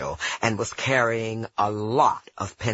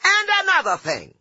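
much higher, louder, fast yell; 5 dB louder, faster than usual. Retold or imitated. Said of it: "I yelled".

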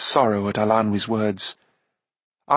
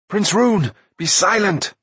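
high, raised-voice warning; sharp command, pitched up; urgent warning; 9 semitones higher, 4 dB louder than usual. A narrator's reading. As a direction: cut him off in alarm. Urgent warning to a blundering companion.

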